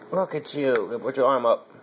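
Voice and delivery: ordinary delivery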